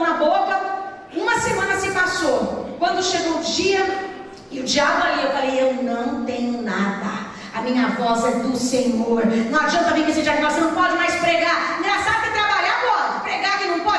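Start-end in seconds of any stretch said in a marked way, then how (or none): 5.6-9.2 low, slow, firm declaration of faith. Retold or imitated. Preacher retelling her answer to the devil, firm declaration. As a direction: state it slowly and low, unshakeable.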